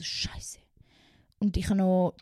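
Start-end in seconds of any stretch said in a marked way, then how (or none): 0.0-0.6 a breathless whispered swear; whispered curse. Retold or imitated. Remembering the exam day, whispered curse. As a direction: hiss it under your breath in dread.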